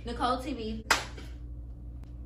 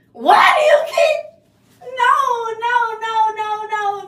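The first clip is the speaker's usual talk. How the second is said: frustrated; 4 semitones higher, 7 dB louder than usual. Real, not acted. Hair keeps curling, she breaks.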